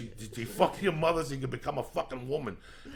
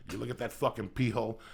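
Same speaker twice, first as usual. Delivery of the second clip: ordinary delivery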